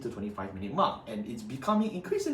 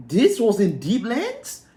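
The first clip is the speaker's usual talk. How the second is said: slow, much higher and louder shocked question; shocked disbelief; 9 semitones higher, 9 dB louder, slower than usual. Real, not acted. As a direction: drag it out in utter disbelief. Outraged at ban list changes.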